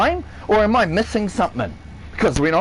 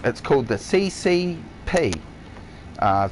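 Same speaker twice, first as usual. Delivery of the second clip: ordinary delivery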